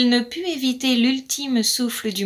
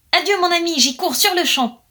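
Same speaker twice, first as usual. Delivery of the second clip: high, loud, eager goodbye; bursting to go, voice up; excited farewell; 7 semitones higher, 5 dB louder than usual. Acted. Blackbird dashes off, calling an excited goodbye to the magpie. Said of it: "excité".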